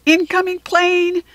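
high, loud warning shout. A narrator's reading. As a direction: yell it across the classroom. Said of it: "George shouted".

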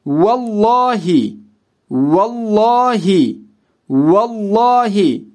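vallahi with a full, heavy dark L; dark, heavy lam in vallahi; thick lam. Shown as a demonstration. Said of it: "lamı kalın bir şekilde okurum".

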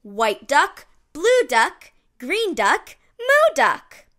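ordinary delivery

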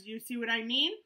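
usual speaking voice

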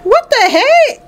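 shocked. Real, not acted. Reaction to the spraying.

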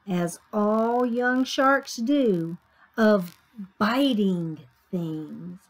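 expressive picture-book reading; story narration. A narrator's reading. Reader tells children of Sammy's first day, story narration. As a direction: read to young children, savoring key words.

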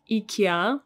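"ikea" opening with an ee sound; Russian pronunciation. Shown as a demonstration. Teacher shows what Russians call it, "ikea" as ee-kee-AH. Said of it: "in Russian we actually call it".

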